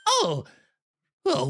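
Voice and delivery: startled. Acted.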